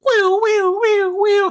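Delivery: very high imitated siren; high-pitched "woo" siren noise; siren wail. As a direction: wail like an alarm announcing breaking news. Siren jingle for mock segment.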